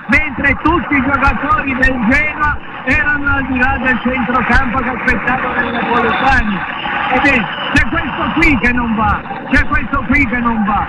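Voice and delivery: normal talking voice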